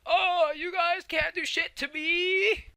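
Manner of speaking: high, loud, drawn-out mock bellow; hammed-up monster taunt. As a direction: taunt them in a huge stretched-out yell. Voices Malachite taunting in fight.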